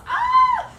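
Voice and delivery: sudden high, loud squeal of pain; mock yelp. Children's yoga story, the bitten mouse squeals.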